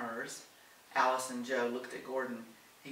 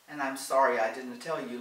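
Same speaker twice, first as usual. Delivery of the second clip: plain reading voice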